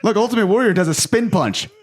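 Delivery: high, excited kid voicing toy battles; boy playing. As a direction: play-act a child smashing action figures. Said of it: "just playing with my Legos".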